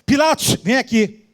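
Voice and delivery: high-pitched indignant summons, easing briefly; acted-out imperious scolding; Jesus ordering Pilatos about. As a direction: demand answers, then call him over. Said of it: "Ele podia falar assim pra Pilatos".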